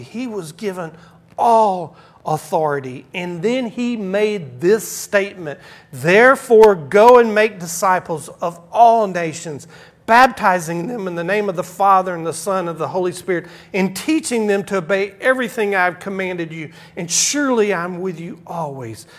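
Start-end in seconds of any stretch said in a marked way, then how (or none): 1.4-3.1 "all" punched, louder and falling; stress on ALL. Shown as a demonstration. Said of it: "please emphasize the word that all authority". 5.9-19.1 loud, driving preacher's reading; preaching scripture. Acted. Reads scripture at full force.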